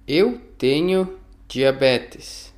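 slow and careful; slow model reading. Shown as a demonstration. Teacher models a phrase for learners, slowly. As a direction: lay each word down slowly. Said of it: "teacher repeats phrase for learners".

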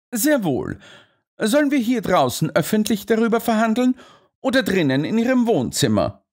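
lightly voiced detective; cool Holmes. A narrator's reading. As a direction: calmly offer him the choice.

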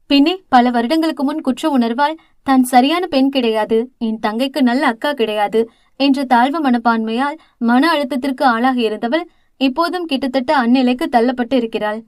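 usual speaking voice

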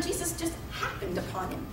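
ordinary talk

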